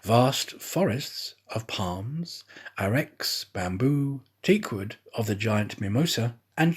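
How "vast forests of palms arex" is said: ordinary delivery, nothing marked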